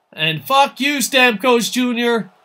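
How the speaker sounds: loud, high-pitched angry curse; emotional outburst. Real, not acted. Streamer cursing a game opponent, loud emotional outburst. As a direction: curse them out, drawing out the name. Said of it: "getting a little emotional sometimes".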